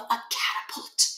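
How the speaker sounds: soft whispered aside; muttered. Acted. Barkin muttering to himself at the locker, whispered. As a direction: mutter it to yourself. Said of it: "the principal muttered to himself".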